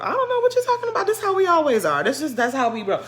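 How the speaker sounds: high, quick, laughing cover-up; high fast playing dumb. Shown as a demonstration. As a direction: squeak it out quickly like you know nothing.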